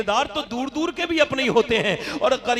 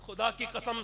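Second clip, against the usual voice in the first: ordinary talk, nothing marked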